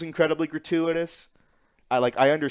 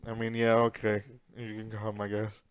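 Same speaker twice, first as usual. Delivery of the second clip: reluctant mumble; 5 semitones lower, 9 dB quieter than usual. Retold or imitated. Retold reluctant Goblin Slayer.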